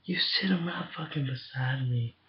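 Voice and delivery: unvoiced whisper to a friend. Real, not acted. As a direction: breathe it out without voice.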